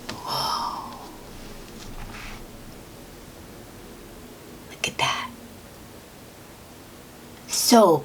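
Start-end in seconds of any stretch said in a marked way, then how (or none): 0.0-5.3 breathed, whispered awe; hushed wonder. Acted. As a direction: gasp softly and whisper in amazement.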